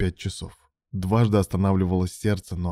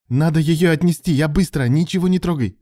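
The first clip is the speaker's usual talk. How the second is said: raised, urgent rush of words; higher, louder, agitated orders; urgent gabble; 9 semitones higher, 5 dB louder than usual. A narrator's reading. Alan urging his friend to touch nothing, gabbling urgently. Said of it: "затараторил Алан".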